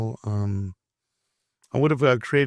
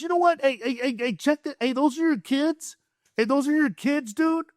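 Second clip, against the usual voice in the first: high, agitated imitation of an angry passenger; worked-up mock challenge in a high voice; fed-up confrontation; 12 semitones higher than usual. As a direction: act out calling someone out, pitched way up. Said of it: "he just can't stand it anymore".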